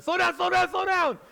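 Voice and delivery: panicked shouting, high and loud, building up; frantic yelling. Retold or imitated. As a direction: yell it at a driver who's going too fast. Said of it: "I'm yelling at her like this".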